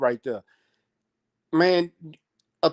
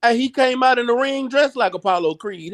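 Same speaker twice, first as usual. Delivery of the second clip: usual speaking voice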